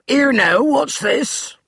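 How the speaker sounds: put-on a crusty old manservant's challenge; startled grumpy servant at the door; gruff butler. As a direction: bark it like a cranky servant. Finch voiced gruffly at the door.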